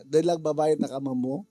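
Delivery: usual speaking voice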